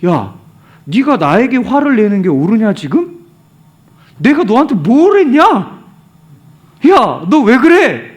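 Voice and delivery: indignant questioning that rises to a loud reproach; God. Acted. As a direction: challenge him like an exasperated parent. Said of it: "하나님이 요나에게 이렇게 물어봐요".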